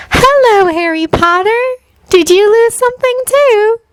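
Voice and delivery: put-on high, airy, faraway girl; dreamy voice. A narrator's reading. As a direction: drift the words like in a daydream. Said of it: "she asked in a dreamy voice".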